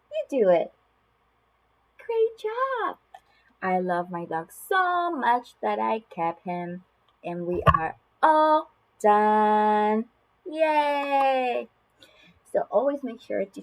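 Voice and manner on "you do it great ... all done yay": big slow, drawn-out finish with a cheer; animated, stretched child-directed cheering; reading to children. Shown as a demonstration. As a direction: celebrate finishing the book with a toddler. Cheers at the end of the book.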